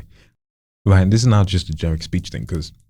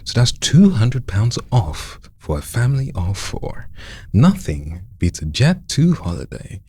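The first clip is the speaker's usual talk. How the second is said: higher, slow, leaning on "two"; emphatic ad read; 5 semitones higher than usual. Shown as a demonstration. Coach shows trainee where to emphasize, higher and slow. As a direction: punch "two" and let the voice go high. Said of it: "things you want to really emphasize".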